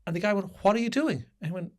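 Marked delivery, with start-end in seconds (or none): 0.6-1.2 higher-pitched, put-out challenge; acted-out indignant query; retold indignant question. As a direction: question him in disbelief, retelling it. Retold confrontation over blocked car.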